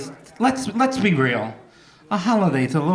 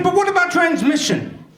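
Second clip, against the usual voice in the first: high pleading question; 10 semitones higher than usual. Retold or imitated. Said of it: "I said".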